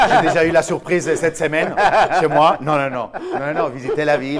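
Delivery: talking through loud laughter, pitch jumping up; laughing hard while protesting it was a joke. Real, not acted. To the hosts, joking about guests at home, laughing loudly.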